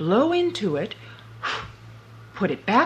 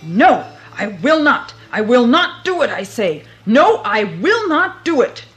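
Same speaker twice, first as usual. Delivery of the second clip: loud defiant shouting; yelling; 4 semitones higher, 9 dB louder than usual. Acted. Boots at the king's gate, loud defiant yelling. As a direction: bellow it at the gate. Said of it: "began yelling as loudly as he could".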